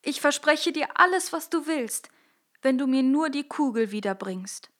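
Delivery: put-on a young princess, upset and pleading. Princess answering the frog in the well, high and upset. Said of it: "sagte sie".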